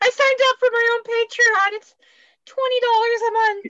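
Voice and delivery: high, loud mock sales pitch; silly high voice. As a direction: squeak it like a goofy ad. Joking Patreon pitch, high voice.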